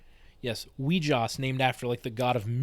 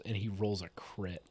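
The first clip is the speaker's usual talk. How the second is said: usual speaking voice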